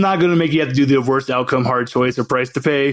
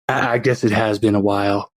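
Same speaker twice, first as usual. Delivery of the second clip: lower, embarrassed mumble; sheepish zoot suit man; 6 semitones lower than usual. Acted. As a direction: caught smelling, admit it. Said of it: "he's just".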